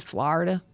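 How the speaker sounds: Florida squeezed to "FLAR-duh"; dad's Florida pronunciation. Retold or imitated. Comic imitating how dad says it, Florida as "FLAR-duh". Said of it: "he says Florida".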